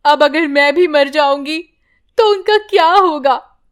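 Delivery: weeping mother praying alone; crying prayer. Acted. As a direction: sob out the prayer. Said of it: "रोने लगती है".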